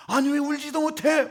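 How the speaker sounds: indignant protest. Acted. Grieving widow protesting to Jesus, indignant and loud. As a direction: push back, offended.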